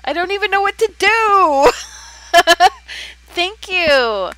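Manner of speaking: overwhelmed with delight at a gift, squealing thanks; high-pitched, giddy gratitude with bursts of laughter; excited gushing. Real, not acted. Thanked for a first Super Chat.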